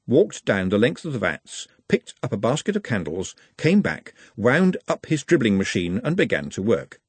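ordinary delivery, nothing marked

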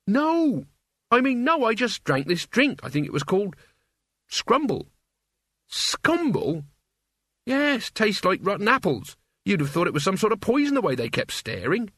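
put-on high, hurried young denial; backpedal, then explain; lightly voiced flustered Mort. Mort flusters while telling Cutwell about the drink.

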